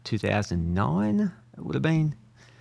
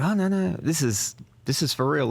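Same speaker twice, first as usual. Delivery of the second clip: mock-eager, higher "oh no no" brushing off doubts; eager agency reassurance; 7 semitones higher than usual. Ad agency insisting the mural job is real, eagerly. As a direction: play the ad people insisting it's real, pitch up. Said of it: "they go".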